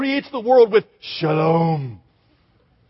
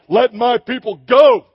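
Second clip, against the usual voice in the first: louder, epic-hero delivery of let my people go; booming movie Moses; 3 semitones higher, 7 dB louder than usual. Retold or imitated. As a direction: declaim it like a big Hollywood leading man. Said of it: "it did not sound like".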